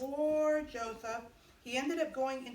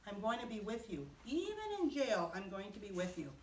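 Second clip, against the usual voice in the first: reassuring God; 5 semitones lower, 7 dB quieter than usual. Acted. God comforting Joseph in jail.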